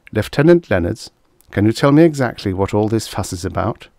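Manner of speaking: usual speaking voice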